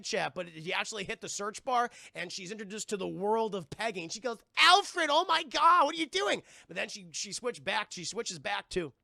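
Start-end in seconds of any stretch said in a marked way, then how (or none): 4.6-6.4 high, loud mock horror; hammed-up appalled outburst, pitched high; scandalized shock. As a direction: cry out as if scandalized. Acting out shock at porn find.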